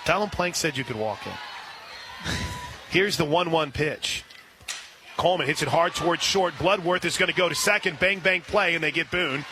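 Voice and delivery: ordinary talk, nothing marked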